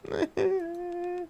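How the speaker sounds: high teary giggle; a breathy, tearful little laugh. Acted. Crust laughs with the commander, high and teary.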